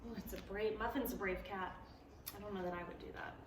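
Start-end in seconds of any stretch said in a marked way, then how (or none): none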